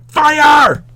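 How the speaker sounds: teacher corrects a flat take into a real scream. Shown as a demonstration. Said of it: "or rather, he screamed".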